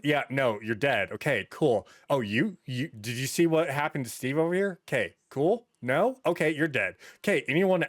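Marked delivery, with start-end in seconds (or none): none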